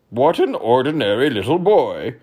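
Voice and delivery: demanding king; pompous king. Acted. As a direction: quiz the boy grandly. King voice held.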